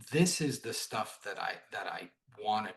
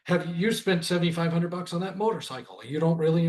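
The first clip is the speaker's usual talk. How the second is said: mock sales rant, higher and louder; retold diatribe; 6 semitones higher, 8 dB louder than usual. Salesman arguing over a customer's motorcycle spend, mock diatribe. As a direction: imitate a pushy salesman launching a diatribe. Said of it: "goes into some endless diatribe".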